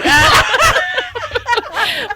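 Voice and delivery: real high-pitched laughing fit; burst of laughter. Real, not acted. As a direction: break off into helpless laughter. Cracking up over the fish.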